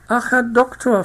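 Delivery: high, loud plaintive wail; a fussy matron moaning to the doctor; complaining plump madame, high-pitched; whining lady. A narrator's reading. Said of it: "fing sie zu klagen an".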